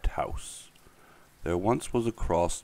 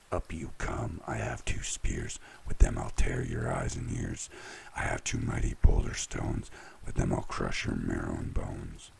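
big goat's very low threat; deep, deep voice; 14 semitones lower, 4 dB quieter than usual. A narrator's reading. Big billy goat threatening the troll, very deep voice. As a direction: challenge from way down deep. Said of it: "in a deep, deep voice".

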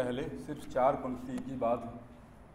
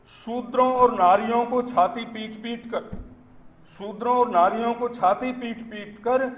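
declaimed verse, higher and fuller than chat; poem recitation; 7 semitones higher, 10 dB louder than usual. Acted. Poet reciting satire on Dalit sympathy to an audience, declaimed recitation. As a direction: declaim it in measured phrases, lifting the line ends.

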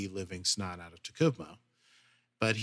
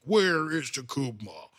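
cranky Klingon; 4 semitones higher, 4 dB louder than usual. Retold or imitated. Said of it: "and like".

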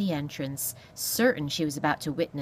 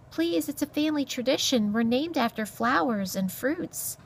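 high little mouse voice; timid mouse; 8 semitones higher than usual. Acted. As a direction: explain politely in a high mouse voice. Timid mouse pleads with beast.

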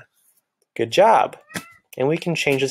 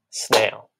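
one clapped beat on "Snail."; clapped syllable. Shown as a demonstration. Teacher clapping out a word for music class. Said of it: "Clap it out".

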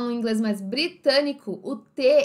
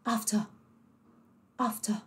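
British after; 7 dB quieter than usual. Shown as a demonstration. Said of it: "Vocês conseguem perceber?".

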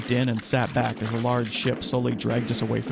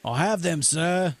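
higher-pitched calling out; yelling over; 6 semitones higher, slower than usual. A narrator's reading. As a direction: call across to another boat. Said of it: "Captain Hartness yelled over".

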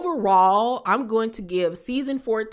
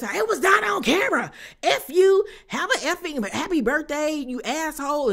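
imitated screaming; 8 semitones higher than usual. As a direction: scream it at her like a furious castmate. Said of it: "all the screaming in the world".

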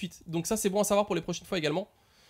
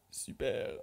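sarcastic whisper; 6 semitones lower, 11 dB quieter than usual. Real, not acted. Sarcastic after losing.

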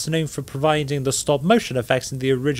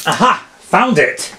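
aha discovery; 6 semitones higher, 4 dB louder than usual. Pretend. Digging up an old film.

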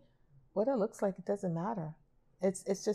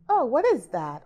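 higher, louder mock questioning; mock puzzled critic; 6 semitones higher, 9 dB louder than usual. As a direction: imitate a critic baffled by a drawing. Critic questioning a child's drawing.

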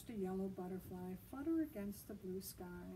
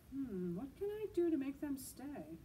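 higher, musing dragon voice; thinking aloud; 6 semitones higher, 3 dB louder than usual. Acted. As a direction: wonder it out loud as the dragon.

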